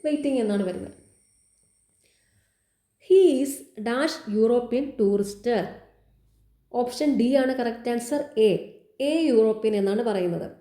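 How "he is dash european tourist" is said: slow question reading. Shown as a demonstration. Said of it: "reads the quiz question aloud".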